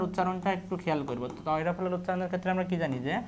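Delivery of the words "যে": normal talking voice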